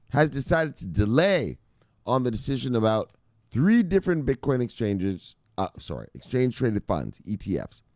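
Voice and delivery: usual speaking voice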